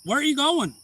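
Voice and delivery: high, loud shout; yelling at a man driving off; retold yell. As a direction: call after him angrily. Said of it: "I'm like".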